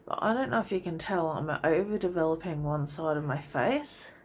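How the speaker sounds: ordinary talk, nothing marked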